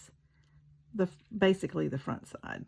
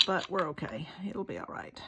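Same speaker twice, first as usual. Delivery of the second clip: ordinary talk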